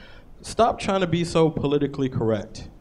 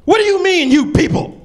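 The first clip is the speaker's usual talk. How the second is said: high indignant shout; loud, high mock outrage; scream; 10 dB louder than usual. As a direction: yell it out as if offended. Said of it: "and then I scream out".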